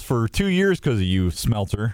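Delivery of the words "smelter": normal talking voice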